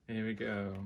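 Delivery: ordinary delivery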